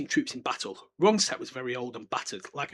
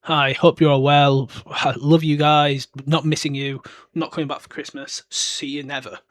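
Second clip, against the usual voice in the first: slow, loud mock-sweet note, louder and slower; pretend letter from Harry; 8 dB louder than usual. Harry's imagined note to Uncle Vernon and Aunt Petunia, mock-sweet and slow. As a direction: read it out like a syrupy postcard, slowly and loud.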